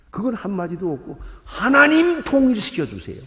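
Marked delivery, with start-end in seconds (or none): 1.4-3.2 loud, high mocking imitation of a demanding prayer; hammed-up pleading cry to God. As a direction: shout it higher, mimicking people who demand. 이게 말이나 됩니까?.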